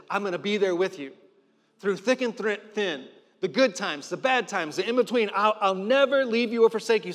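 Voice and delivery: builds from firm to full-force preaching; rising fervent promise to the church; preaching, building up. Acted. God's promise, rising fervour.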